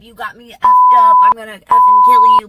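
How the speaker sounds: loud, high raging yell; hammed-up furious yell; mock rage. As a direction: scream the threat in mock fury. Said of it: "You're a scammer".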